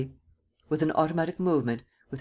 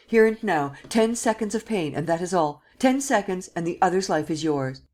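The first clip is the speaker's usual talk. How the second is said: commanding shout; 4 semitones higher than usual. Acted. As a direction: drive the order home. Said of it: "shouted Sernine".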